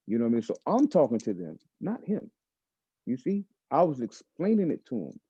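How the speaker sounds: normal talking voice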